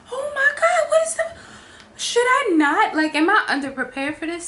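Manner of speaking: high, loud, laughing-crying panic; half-laughing freakout; panicked. Retold or imitated. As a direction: squeal it in nervous disbelief. Panicking at staff question.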